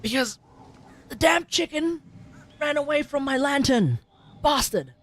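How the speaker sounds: usual speaking voice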